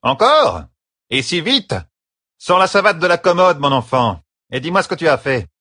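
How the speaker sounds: high-pitched surprise turning to orders; exclaim, then order the pupil sternly; astonished scolding. Acted. Mistress astonished, then orders confession.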